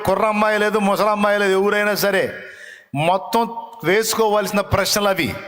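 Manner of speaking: preaching at full force. Acted. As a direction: press the congregation with each line. Preacher drives home point.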